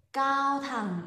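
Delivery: normal talking voice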